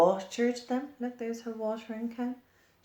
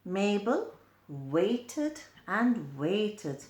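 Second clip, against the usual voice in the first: ordinary delivery, nothing marked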